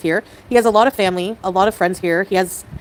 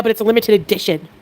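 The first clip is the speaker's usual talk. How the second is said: ordinary talk, nothing marked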